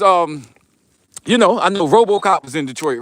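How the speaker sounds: usual speaking voice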